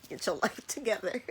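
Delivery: giggling self-scold; laughing through it. Pretend. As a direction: crack up mid-sentence. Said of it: "I was just laughing at myself".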